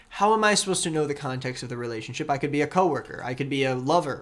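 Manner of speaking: ordinary talk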